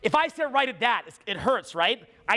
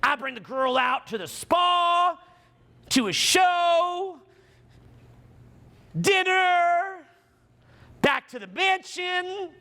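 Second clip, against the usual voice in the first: excited dreaming; 7 semitones higher, slower than usual. Acted. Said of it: "you're like".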